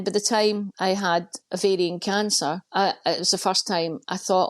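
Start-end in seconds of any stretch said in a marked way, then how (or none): none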